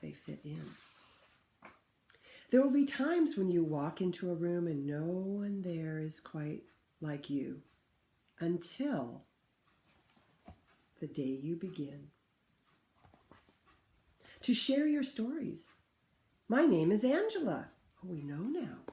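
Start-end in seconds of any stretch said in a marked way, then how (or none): 2.5-15.8 put-on clear, louder read-aloud for children; story reading. Teacher reads picture book aloud. 16.5-17.7 put-on higher, louder child voice; a girl finally saying her name; shy girl speaking up. Girl introducing herself to class.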